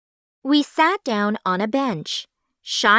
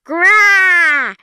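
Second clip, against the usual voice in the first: much higher, loud zombie shriek; furious zombie scream; 9 semitones higher, 8 dB louder than usual. A narrator's reading. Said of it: "She howled furiously".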